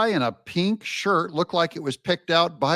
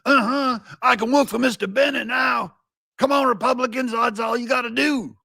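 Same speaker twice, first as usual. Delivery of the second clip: put-on high-pitched old-time stage caricature; hammed-up minstrel-show voice; 10 semitones higher than usual. Host mocking the pundit's delivery, minstrel caricature. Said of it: "a nineteen forties minstrel?".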